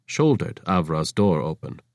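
plain reading voice

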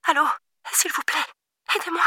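put-on a scared girl begging in a small voice; pleading. A narrator's reading. Frightened girl pleading for help.

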